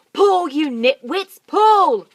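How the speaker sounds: very loud, high slow yell; heave-ho shout at helpers; yelled order. Acted. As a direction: Mugglewump yelling at his helpers. Mugglewump yelling.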